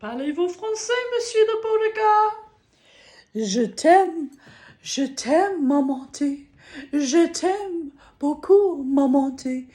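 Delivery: high-voiced playful declaration of love in French; playful French cooing. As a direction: coo pretend French endearments, pitched high. Playful French love talk to Monsieur Le Beauregard.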